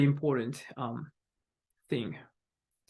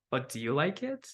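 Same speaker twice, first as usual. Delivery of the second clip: softer question, lifting on "it"; rising intonation; 6 dB quieter than usual. Shown as a demonstration. Asking someone who tried new food, rising intonation. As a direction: ask gently upward, "it" rising. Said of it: "With rising intonation".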